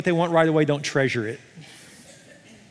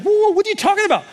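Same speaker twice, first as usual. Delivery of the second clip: very high, fast, louder protest; hammed-up taken-aback objection; startled protest; 5 dB louder, faster than usual. Preacher as Hannah confronted by Eli, high startled protest.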